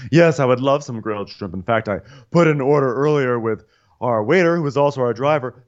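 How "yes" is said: ordinary talk, nothing marked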